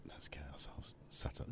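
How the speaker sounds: soft breathy aside to himself; hushed whisper while setting up the gear. Real, not acted. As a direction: whisper it, half to yourself.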